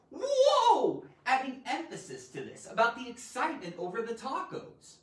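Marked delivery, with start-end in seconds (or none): 0.1-1.0 very high, loud excited whoa. Shown as a demonstration. Teacher showing the emphasis an exclamation point gives. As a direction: burst out with a big whoa. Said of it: "the exclamation point is".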